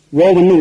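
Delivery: ஓவன்னு with a long O at the start; common mispronunciation. Retold or imitated. Teacher quoting people's mistake, ஓவன்னு with long O. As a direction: say oven as "oh-ven". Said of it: "உச்சரிக்கிறாங்க".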